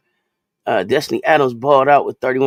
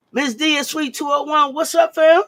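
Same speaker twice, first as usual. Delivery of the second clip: excited greeting; 11 semitones higher, 5 dB louder than usual. Real, not acted. Greeting a viewer in chat.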